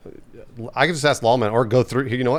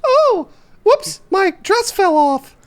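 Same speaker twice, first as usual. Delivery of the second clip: put-on very high squeaky anime girl; cutesy high-pitched anime girl gasp; much slower than usual. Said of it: "I also do a really good anime girl".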